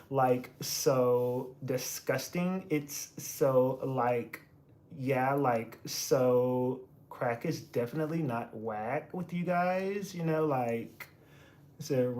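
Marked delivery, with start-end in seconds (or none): 0.1-11.1 put-on soft, slow Valley Girl uptalk. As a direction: drawl it like a bored Valley Girl. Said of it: "The Valley Girl voice is killing me".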